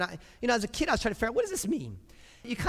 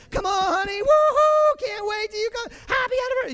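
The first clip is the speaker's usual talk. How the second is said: very high, loud whooping cheer; mock cheering; 7 dB louder than usual. Preacher mocking cheering at a birthday party, whooping high. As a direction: cheer like a crowd for the birthday boy. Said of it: "I don't cheer downstairs".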